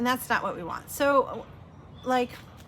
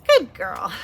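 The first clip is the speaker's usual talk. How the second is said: therapist praising; 3 semitones higher, 10 dB louder than usual. Retold or imitated. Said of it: "And she's like".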